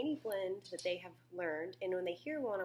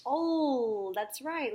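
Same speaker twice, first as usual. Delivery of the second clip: high, loud, stretched confirmation; louder, higher classroom praise; encouraging teacher; 6 semitones higher, 8 dB louder, much slower than usual. Shown as a demonstration. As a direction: cheer the pupil's correct answer. Teacher confirming pupil's answer.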